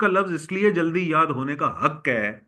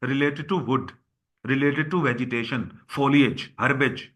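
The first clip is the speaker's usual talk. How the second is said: slow dictation; much slower than usual. Shown as a demonstration. Teacher dictates synonyms slowly.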